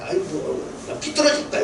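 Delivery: put-on a whiny teenager's pout, pitch jumping high; sulky teen. Pastor mimics a rebellious teen to the congregation, sulky and high. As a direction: toss it off like a sulking kid. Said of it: "아이들 그 많이 방황하잖아요".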